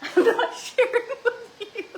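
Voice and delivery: high, loud laughing refusal; laughing tease. Real, not acted. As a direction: tease him through laughter.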